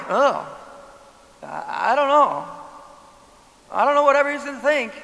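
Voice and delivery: hesitant, then a fast anxious mumble; worried about what people think; nervous hesitation. Retold or imitated. Preacher voicing a timid believer, nervous. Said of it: "Or you're like".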